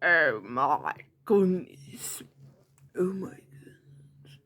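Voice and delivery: slow, low, drawn-out exclamation; slow cartoonish voice, trailing into breath; put-on character voice. Shown as a demonstration. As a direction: stretch it out low, fading to a whisper. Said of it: "do face, vo-, voices like".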